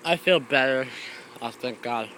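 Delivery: usual speaking voice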